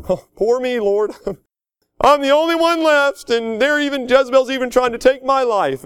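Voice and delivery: high, whiny mock lament to the Lord; self-pitying Elijah. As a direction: wail it like a man feeling sorry for himself. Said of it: "that complex of "poor me".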